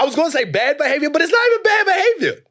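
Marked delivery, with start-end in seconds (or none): 1.1-2.4 exasperated shout. Real, not acted. Exasperated outburst on a point.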